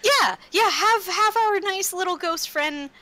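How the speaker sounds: bubbly eager approval; full-hearted agreement. Acted. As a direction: gush with delight at the plan. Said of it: "saying full-heartedly".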